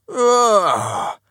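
high, loud cry of alarm; loud wordless outcry; startled cry. A narrator's reading. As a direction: jolt awake with a loud, high yell. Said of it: "cried Mister Grunt".

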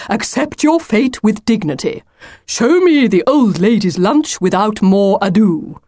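lightly voiced pompous wolf; impatient wolf, demanding. Wolf ordering Lucy to show the lunch, demanding. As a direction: issue a grand demand.

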